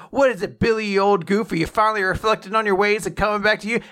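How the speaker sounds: high, chummy play-acted greeting; friendly teasing. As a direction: hail an old pal with playful ribbing. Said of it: "being kind of friendly".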